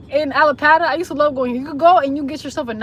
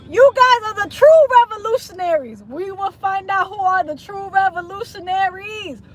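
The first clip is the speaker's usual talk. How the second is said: mock proclamation; 3 semitones higher, 3 dB louder than usual. Hailing Haiti as revolutionaries, mock grand proclamation. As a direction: proclaim it grandly like a stump speech.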